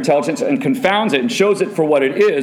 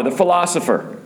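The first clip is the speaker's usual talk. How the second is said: ordinary talk, nothing marked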